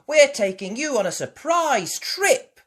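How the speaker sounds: put-on high, loud excited girl; bursting with news; excited Peppa. A narrator's reading.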